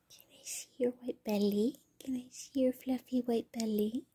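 soft breathy whisper to the cat; hushed pet talk. Real, not acted. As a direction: whisper it like you're coaxing a pet. Cooing to cat's belly.

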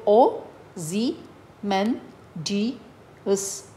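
"ओ जी मैन डी एस" in five slow, separate syllables. Shown as a demonstration. Teacher showing the chin-count method, "ओ जी मैन डी एस" syllable by syllable. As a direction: pause between each beat of "ओ जी मैन डी एस". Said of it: "then count the movement".

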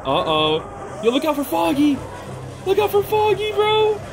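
ordinary talk, nothing marked

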